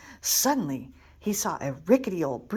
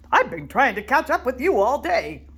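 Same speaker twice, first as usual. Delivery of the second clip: giant explaining; 5 semitones higher, 6 dB louder than usual. Acted. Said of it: "explained the giant".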